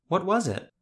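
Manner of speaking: put-on insistent boy. A narrator's reading. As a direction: demand it like a stubborn kid. Boy pressing again, insistent.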